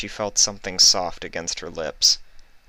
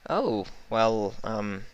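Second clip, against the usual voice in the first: false smile; 5 semitones higher, much slower than usual. Acted. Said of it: "with a false smile".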